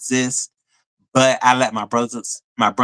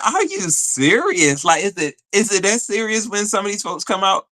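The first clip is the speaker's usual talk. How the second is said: incredulous; 7 semitones higher than usual. Retold or imitated. Questioning her friend's denial, high and incredulous. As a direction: stretch it out in disbelief. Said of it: "I said".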